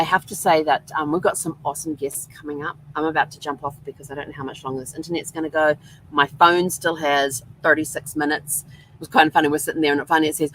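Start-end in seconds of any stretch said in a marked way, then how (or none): none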